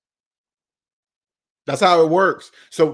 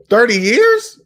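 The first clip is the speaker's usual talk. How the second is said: incredulous; 6 semitones higher, 5 dB louder, slower than usual. Real, not acted. Outraged at mortgage length.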